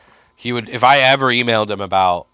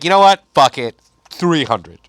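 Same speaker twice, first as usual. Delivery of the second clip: cocky bargaining; 4 dB louder than usual. Retold or imitated. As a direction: toss out the price like a dare. Manager upping the price on a whim.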